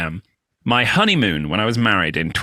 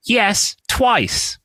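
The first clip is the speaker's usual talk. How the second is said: mock serious; 10 semitones higher than usual.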